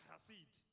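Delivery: "chesed" with guttural KH, modelled for repetition; Hebrew pronunciation. Shown as a demonstration. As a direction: say "chesed" as khah-SEED. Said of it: "Pronounce it".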